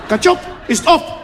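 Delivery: high, questioning mock Chinese accent; "Ketchup" as a high "katchop", imitating a Chinese accent. Chinese restaurant owner puzzled by customer, high mock Chinese accent. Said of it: "Ging ik naar de Chinees".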